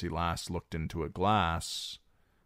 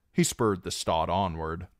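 plain reading voice

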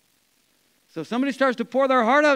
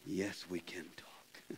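whisper; 21 dB quieter, much slower than usual. Shown as a demonstration. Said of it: "Can we talk?".

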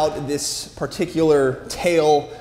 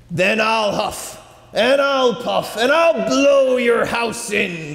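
higher, slow and menacing; werewolf; 6 semitones higher, slower than usual. Acted. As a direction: threaten with rising force. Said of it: "the wolf cried back".

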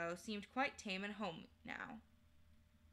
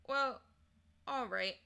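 usual speaking voice

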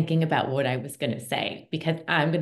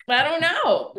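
laughing; 12 semitones higher than usual. Real, not acted. Laughing at own joke.

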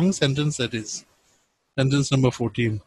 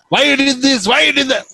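mock scolding, higher and louder; 9 semitones higher, 5 dB louder than usual. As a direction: nag like an irritated scold. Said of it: "Not the usual voices that we hear".

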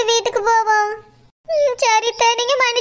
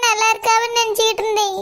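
normal talking voice

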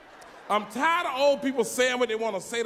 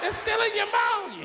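mock shock; 11 semitones higher, slower than usual. Comic stunned cousin still chewing chicken, high and shocked. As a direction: gasp it out incredulous.

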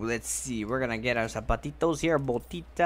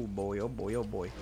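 usual speaking voice

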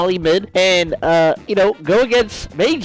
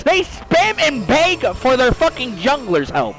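usual speaking voice

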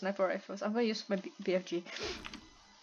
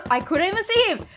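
higher-pitched real complaint; frustrated protest; 9 semitones higher, 4 dB louder than usual. Real, not acted. Gamer killed by someone unseen, frustrated protest. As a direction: protest the unfair death.

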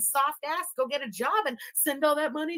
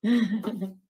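usual speaking voice

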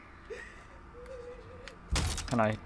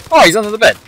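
excited shout; 4 semitones higher, 34 dB louder, faster than usual. Real, not acted. Streamer spots hidden enemy.

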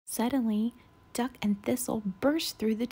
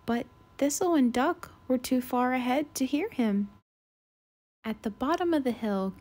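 usual speaking voice

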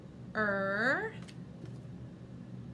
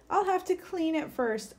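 mock upset cry; 7 semitones higher than usual. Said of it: "she cried".

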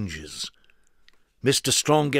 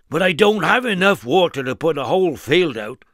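louder, higher fretful protest; worried farmer; 4 semitones higher, 5 dB louder than usual. Acted. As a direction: plead helplessly. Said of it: "cried the worried farmer".

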